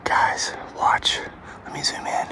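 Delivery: hushed tense whisper. Real, not acted. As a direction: whisper while watching something. Whispering, spotting something brown.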